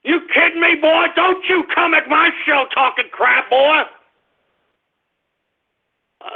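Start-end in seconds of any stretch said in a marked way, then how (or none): 0.0-3.8 heated retort. Real, not acted. Host mocks caller Alex Jones, heated high taunt. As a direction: taunt him right back, sharp and high.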